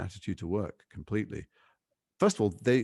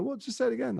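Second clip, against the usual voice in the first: What, pitched far higher in mock disbelief; retold baffled protest. Actor to Jackie Chan in the car, high-pitched mock bafflement. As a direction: squeak it out, baffled. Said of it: "I go".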